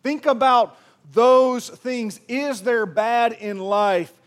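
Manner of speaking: loud, slow, emphatic sermon delivery; full-force preaching. Acted. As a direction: drive each line home to the congregation.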